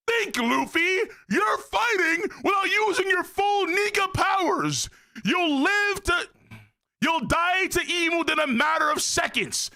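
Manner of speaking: high, drawn-out anime yell; hammed-up high-pitched scolding; urgent shouting. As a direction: shout a desperate warning, stretching the words. Acts out manga character scolding Luffy.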